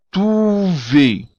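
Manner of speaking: trouver, "oo" sound and stress at the end; Creole word. Shown as a demonstration. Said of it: "como se estivesse acentuado".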